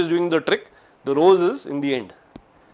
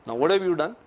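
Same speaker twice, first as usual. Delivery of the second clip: ordinary talk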